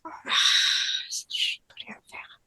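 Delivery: very faint, helpless whisper; barely audible admission of defeat. Acted. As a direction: whisper it as if all strength is gone. Channeled chief admits defeat.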